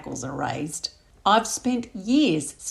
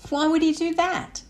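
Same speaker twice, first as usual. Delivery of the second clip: high, startled and louder; shocked question; 10 semitones higher, 5 dB louder than usual. Acted. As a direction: gasp out the question in disbelief. Lydia shocked by Gary's story.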